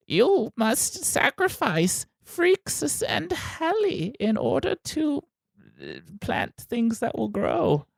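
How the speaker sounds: very high, slow eerie prophecy; oracle voice. Acted. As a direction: intone it like an otherworldly oracle. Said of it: "here I am imitating your oracle voice".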